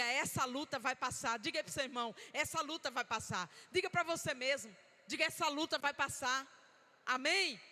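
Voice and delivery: rallying pulpit cry; preaching declaration. Acted. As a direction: proclaim it so they echo back. Leads the church in declarations.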